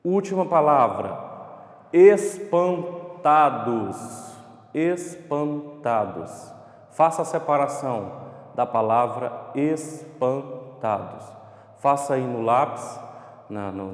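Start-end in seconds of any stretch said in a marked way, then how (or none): 1.9-6.5 "espantados" very slow, broken into syllables; sílaba por sílaba. Shown as a demonstration. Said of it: "Você fala ela devagar, sílaba por sílaba". 9.5-11.2 "espantados" slowed into separate syllables; sílaba por sílaba. Shown as a demonstration. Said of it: "Você fala ela devagar, sílaba por sílaba".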